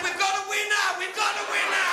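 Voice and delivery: excited shout. Retold or imitated. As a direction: announce the winner like a game host. Retold host shouting winner.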